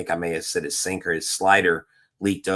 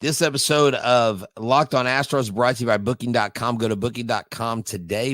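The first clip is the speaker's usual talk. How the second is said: ad read; 3 semitones higher than usual. Acted. Host reads sponsor ad.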